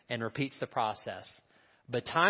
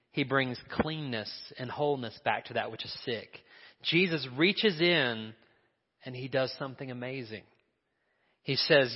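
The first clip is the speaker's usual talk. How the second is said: ordinary delivery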